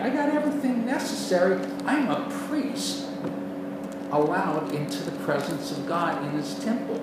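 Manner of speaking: high, loud preacher's cry; preaching at full force. Acted. A preacher lifting the congregation, loud and soaring. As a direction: lift the congregation, proclaim it.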